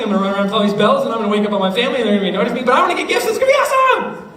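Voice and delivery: rising, fast giddy excitement about Christmas; excited kid. Retold or imitated. Kid gushes about Christmas gifts, giddy and rising. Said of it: "that's like".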